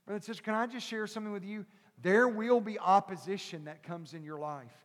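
ordinary talk

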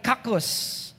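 "kakos" said softly with a falling tune; quiet emphasis. Shown as a demonstration. Preacher quietly stresses kakos as suffering. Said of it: "That's the point".

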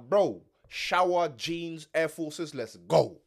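loud, slow barked orders; bossy wake-up call. Retold or imitated. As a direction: order him out of bed. Retold commanding friend.

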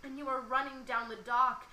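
lively spoken-word poem recital; poem reading. Acted. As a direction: perform the poem to the listener. Reads her love poem aloud.

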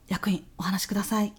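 whispered aside to the boss; hushed so the client can't hear; quick whispered prompt. Acted. Whispering to colleague during meeting.

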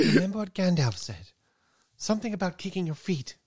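high, loud Frodo impression. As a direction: play Frodo pleading, pitched high.

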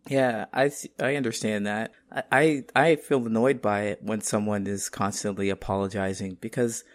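usual speaking voice

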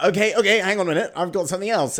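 loud, high, hurried pitch; excited. Pretend. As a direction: win her over loudly and fast. Boris voiced, pleading loudly.

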